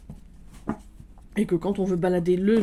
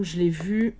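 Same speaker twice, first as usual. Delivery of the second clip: usual speaking voice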